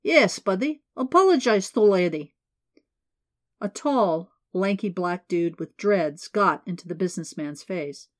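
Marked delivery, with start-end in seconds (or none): 0.0-2.3 put-on firm, louder Eastern European accent; demanding with an Eastern European accent. A narrator's reading. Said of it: "Thick accent, definitely Eastern European".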